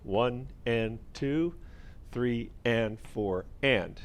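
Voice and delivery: slow, spaced-out count. Shown as a demonstration. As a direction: spell out each beat slowly. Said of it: "So the counts here would be".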